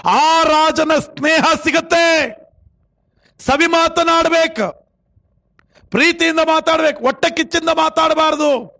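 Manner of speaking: sustained high sermon cry, endings stretched; forceful preaching. Acted. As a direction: exhort the congregation point by point. Preaches to congregation. (ಏರುದನಿಯ ಪ್ರವಚನ)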